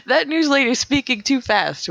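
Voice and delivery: high-pitched mock listener complaint; complaining caller. Radio listener calling in about the newsreader, mock complaint. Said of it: "someone will call and say".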